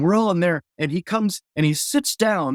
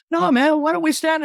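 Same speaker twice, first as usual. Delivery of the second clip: eager plea; 11 semitones higher than usual. Retold or imitated. Pleading to stay with Jerry.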